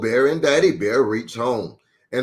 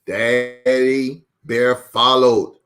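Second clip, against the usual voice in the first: ordinary delivery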